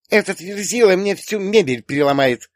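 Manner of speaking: put-on high, loud girlish grumble; grumbling. A narrator's reading. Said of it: "забурчала девушка". (ворчливо, высоким девичьим голосом)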